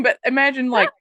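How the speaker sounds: normal talking voice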